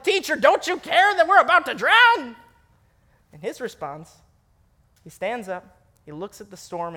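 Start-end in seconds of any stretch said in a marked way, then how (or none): 0.0-2.4 higher, louder frantic cry, half laughing; mock terrified disciples shouting; panicked yell. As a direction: yell it like you're about to drown. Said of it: "they yell at him".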